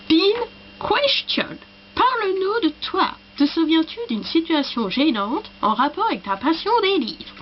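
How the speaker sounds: high, swooping mock English accent. Shown as a demonstration. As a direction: read it in French with a put-on English accent. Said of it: "l'accent anglais".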